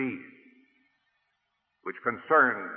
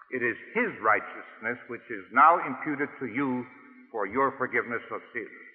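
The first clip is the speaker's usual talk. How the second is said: normal talking voice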